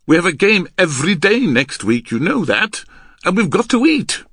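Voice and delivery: loud, climbing, emphatic argument; heated insistence. Acted. Man pressing his partner to practise, heated. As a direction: drive it home, rising to the end.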